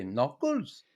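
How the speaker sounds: put-on slow Swedish-accented teasing. Swedish teammate greeting Knuckles each morning, Swedish accent. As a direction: imitate a Swede's deliberate, accented English. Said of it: "didn't lose his accent".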